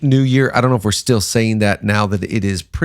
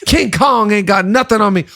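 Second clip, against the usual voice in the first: higher-pitched, emphatic star-actor impression; Denzel; 8 semitones higher than usual. Host mimics his favorite Bible narrator, emphatic actor impression. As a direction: imitate the actor's big boastful line. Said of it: "how would he read David?".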